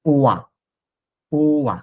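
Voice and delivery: ওয়া with falling "wa", not "o-i"; French "oi" sound. Shown as a demonstration. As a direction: say ওয়া as one "wa" glide. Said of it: "that will be sound like ওয়া". (ওয়া উচ্চারণ, "ওই" নয়)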